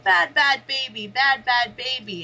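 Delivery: a hint of a distraught little child chanting; imitated upset toddler. Toddler smacking her own butt after being yelled at, lightly imitated. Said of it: "and she was like".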